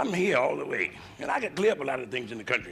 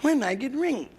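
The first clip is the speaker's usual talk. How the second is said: nagging wife; 3 semitones higher than usual. Retold or imitated. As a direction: whine it like the wife who won't let up. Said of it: "she asked me".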